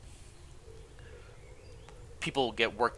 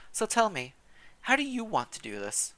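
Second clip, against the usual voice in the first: put-on a friendly old servant, pitched up; old housekeeper; 9 semitones higher than usual. Housekeeper Gilberte greeting the newcomer, high old woman voice.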